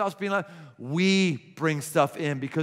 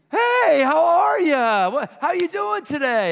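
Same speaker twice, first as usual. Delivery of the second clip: much higher, louder, slow beaming Hey; overjoyed greeting; 10 semitones higher, 7 dB louder, slower than usual. Shown as a demonstration. Neighbor calling across the yard, high loud delighted greeting. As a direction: greet a neighbor like you're thrilled to see them. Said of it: "if you went".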